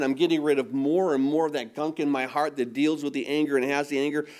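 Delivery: ordinary talk, nothing marked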